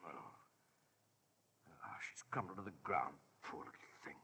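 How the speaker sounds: soft, stricken whisper. Acted. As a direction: breathe it out in a hushed whisper. Watching the execution in shock.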